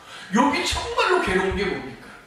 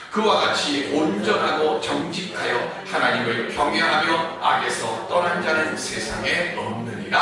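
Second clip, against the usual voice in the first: scripture reading; 5 semitones lower than usual. Acted. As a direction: read each line as sacred text, measured and weighty. Reads scripture aloud.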